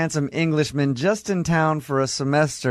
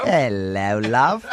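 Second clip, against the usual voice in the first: mock British accent; 4 semitones lower, much slower than usual. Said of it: "happens to be a sexy Englishman".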